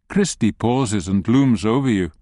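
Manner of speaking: usual speaking voice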